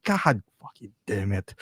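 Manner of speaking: low, slow curse of defeat; mock exasperation. Toy maker stuck making another Loki, mock exasperation. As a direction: drag it out in mock despair.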